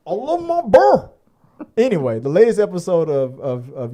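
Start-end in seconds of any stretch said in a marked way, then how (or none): 0.1-1.1 much higher, slower mock Southern voice; giddy Southern woman. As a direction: squeal it like a Southern woman hugging her prize.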